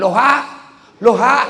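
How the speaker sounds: high, loud loha with a rising end; reversed shouted hello. Retold or imitated. Old man shouts back after being told it's upside down. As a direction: yell the word backwards, confused. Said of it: "Dia bilang".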